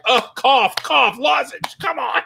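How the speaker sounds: high, pleading, stretched-out urging; high and slow, begging her to cough. Retold or imitated. Urging the DJ on air to cough and take a lozenge, high and pleading. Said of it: "And I'm like".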